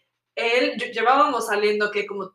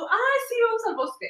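mock naive voice; 10 semitones higher than usual. Friend imitates Pris agreeing.

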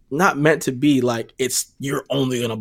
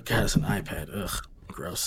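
annoyed ugh; 5 semitones lower, 11 dB quieter, slower than usual. Real, not acted. Disgusted at an iPad.

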